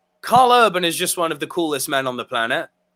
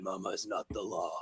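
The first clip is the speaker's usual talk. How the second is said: very low, hushed menace; deep, deadpan lawman line; Dredd's voice; 12 semitones lower, 18 dB quieter than usual. Acted. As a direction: drop to a deep, quiet threat. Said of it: "when he drops the line".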